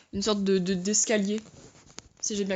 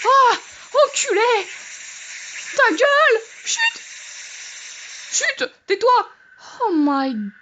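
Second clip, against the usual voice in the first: frightened yell; 11 semitones higher, slower than usual. Real, not acted. Startled by a screamer, shouting at it.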